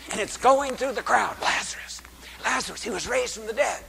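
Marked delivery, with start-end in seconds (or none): 1.4-3.8 hushed rumor. Acted. Crowd murmur passing the news.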